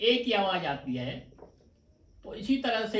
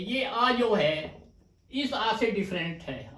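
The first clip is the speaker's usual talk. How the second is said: ordinary talk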